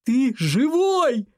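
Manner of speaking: high, drawn-out happy exclamation; elated, stretched-out cry; joyful relief. A narrator's reading. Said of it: "Алекс громко рассмеялся".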